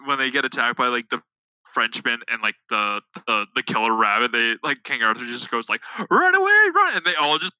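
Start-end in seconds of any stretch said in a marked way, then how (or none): 6.1-7.0 high, loud mock cry of panic; hammed-up panicked yelp, high and loud. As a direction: shriek it like a fleeing knight. Quoting King Arthur fleeing.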